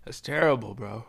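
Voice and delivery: a hint of a friend feigning pity; imitating an onlooker.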